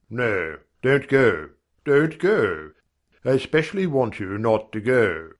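plain reading voice

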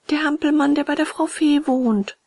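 high puppet voice calling up; high-pitched puppet answer; puppet explaining. A narrator's reading.

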